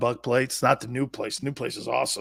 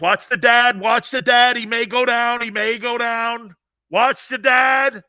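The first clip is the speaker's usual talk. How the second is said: loud, higher repeated alarm; urgent warning; 10 semitones higher, 7 dB louder than usual. Retold or imitated. Doctor warning staff about the fainting dad, loud. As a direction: warn the nurses fast. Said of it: "And the doctor goes".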